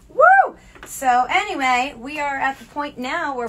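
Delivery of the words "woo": loud high woo; big happy whoop; excited whoop. Real, not acted. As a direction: cheer it out. Cheer while knitting.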